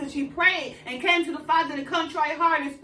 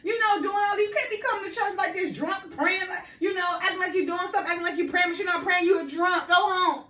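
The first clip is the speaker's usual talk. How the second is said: higher, lightly voiced scolding priest; the priest chiding a woman he thinks is drunk; 4 semitones higher than usual. Priest scolding Hannah for praying drunk, higher mock scold. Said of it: "And he was like".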